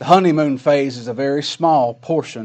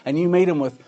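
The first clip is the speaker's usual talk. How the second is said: ordinary talk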